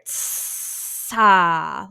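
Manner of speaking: "Tsa" with a long hiss bursting open; pressurized air. Shown as a demonstration. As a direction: build up the hiss, then pop into "aa". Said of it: "pressurized air".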